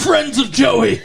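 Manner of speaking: high, loud echoing yell breaking into laughter; hammed-up imitation of a warning call; mock warning shout. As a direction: shout it mockingly, on the edge of laughing.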